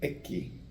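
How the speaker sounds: Eki whispered softly, "EH-kee"; Eki, breathed as a two-syllable "EH-kee"; Spanish letter X. Shown as a demonstration. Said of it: "the next sound is".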